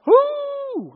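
high, loud mock wolf howl; imitated dog howl. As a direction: throw your head back and howl like the dog. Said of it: "I'm talking about".

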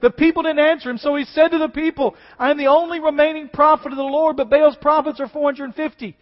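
loud, high, impassioned preaching; preaching at full force. Acted. Preacher booming Elijah's challenge to the people. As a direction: proclaim it to the congregation.

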